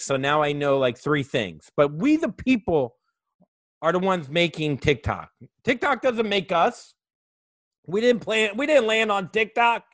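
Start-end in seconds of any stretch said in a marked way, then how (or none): 5.6-9.8 impassioned rallying cry. Real, not acted. Rallying rant about the people.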